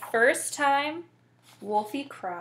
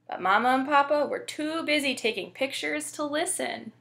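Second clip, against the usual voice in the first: ordinary delivery, nothing marked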